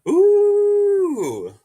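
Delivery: high, loud delighted ooh; excited squeal. Real, not acted. Reacts excitedly to a chat message.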